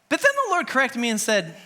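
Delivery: usual speaking voice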